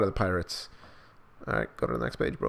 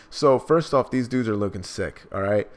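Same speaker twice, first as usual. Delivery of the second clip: ordinary talk, nothing marked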